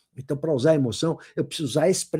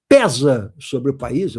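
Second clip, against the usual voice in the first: slow, weighty dramatic read; grave newspaper-editorial drama; 4 semitones lower, 5 dB louder, much slower than usual. Shown as a demonstration. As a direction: land heavily on the first word, drawn out. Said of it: "vou fazer uma entonação de voz pra parecer dramático".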